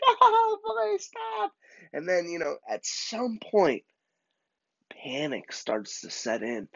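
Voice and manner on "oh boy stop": high, giggly squeal imitating her; mock laughing protest. Tied-up girl protesting to the boys, mock laughing squeal. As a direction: lightly act out a laughing plea. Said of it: "She's laughing. She's like".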